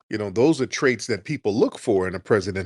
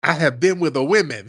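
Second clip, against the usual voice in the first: higher, prim, deliberate quote; mock Andrew Caldwell; 5 semitones higher, 5 dB louder than usual. Mocking the delivered guy claiming women, higher and prim. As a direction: say it with mock dignity. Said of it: "can't get his plurals".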